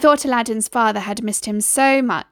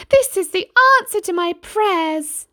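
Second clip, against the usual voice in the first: high, louder mother's voice full of relief; grateful mother; 9 semitones higher, 4 dB louder than usual. A narrator's reading. Mother thanking the rich uncle, high relieved voice.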